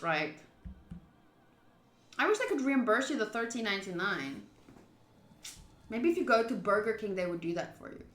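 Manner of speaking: ordinary talk, nothing marked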